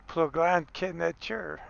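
slow, badly accented French; terrible French accent. Bilingual sign read out for viewers, in a terrible French accent. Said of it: "terrible French accent".

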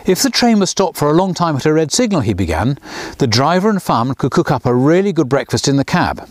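plain reading voice

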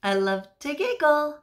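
high, loud and playful; playful read-aloud. Acted. Teacher reads the giggle page to children. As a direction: read it bouncy for the kids.

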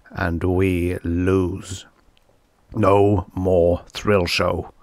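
very slow, weighty delivery; grim verdict. A narrator's reading. Producer warning the staff of losing everything, slowly and grimly. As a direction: let each word sink in.

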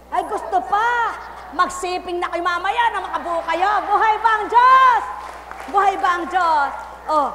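loud, high fiery preaching; preaching at full force. Acted. Preacher calling out to the congregation, fiery and loud. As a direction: call it out to the crowd.

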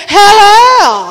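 "hello" drawn out like "hair-lair"; high, loud, upper-class "hello" ending in "air"; posh hello as hair-lair. Shown as a demonstration. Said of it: "put them all together, you say".

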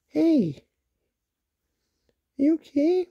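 ordinary talk, nothing marked

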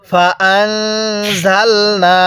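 ফাআনজালনা very slow with a held nasal hum on the n; nasal ghunnah. Shown as a demonstration. Teacher demonstrates ghunnah on ফাআনজালনা, very slow. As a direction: hold the নুন with ghunnah through the nose, slowly. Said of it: "নুন সাকিনের মধ্যে গুনাহ করব".

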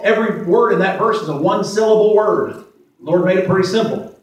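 ordinary talk

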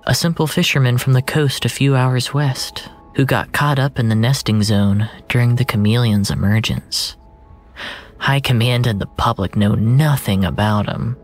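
ordinary delivery

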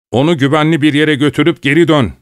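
high, loud shout across water. A narrator's reading. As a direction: yell over the noise of the flood. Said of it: "diye bağırdım". (suyun sesini bastıran bağırış)

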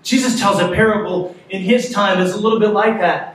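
ordinary talk, nothing marked

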